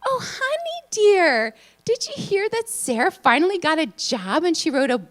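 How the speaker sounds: half-deaf aunt announcing it across the room; imitating the hard-of-hearing aunt talking loudly and high; loud old aunt. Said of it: "she said really loudly to her husband".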